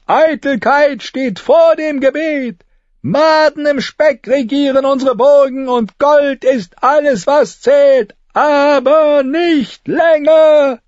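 very high, loud preaching tirade; ranting street prophet, shrill. A narrator's reading. Begging brother denouncing the corrupt court to a crowd, shrill rant. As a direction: shriek your condemnation at the whole crowd.

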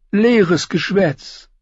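high, slow soothing dismissal; Varys dismissive. A narrator's reading. Varys calming Joffrey, high and slow. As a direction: brush it aside smoothly. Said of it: "sagte Varys der Eunuch".

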